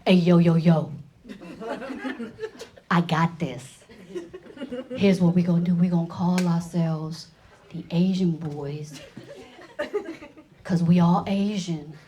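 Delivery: a hint of a low street-thug swagger; lightly voiced gangster brother. Brothers naming their gang, lightly voiced swagger. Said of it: "one day they were like".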